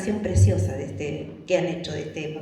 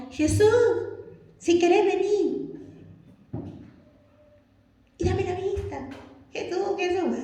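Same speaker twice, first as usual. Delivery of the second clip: mock timid plea; 10 semitones higher than usual. Acting out a half-hearted asker calling Jesus, timid high voice.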